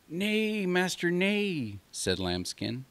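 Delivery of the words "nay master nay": much higher, slow little lamb voice; timid lamb. Acted. Lamb pleading with the wolf, high and timid. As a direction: plead meekly like a small lamb.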